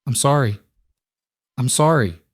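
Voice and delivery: choked between sobs. A narrator's reading. Girl keeps apologising to the woman, choked with sobs. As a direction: repeat the apology through acted tears. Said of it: "choked out between sobs".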